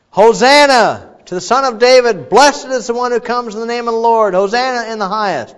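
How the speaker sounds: loud, high, joyful crowd cry; crowd shouting. Retold or imitated. Preacher voicing the crowds hailing Jesus, loud and high. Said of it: "kept shouting".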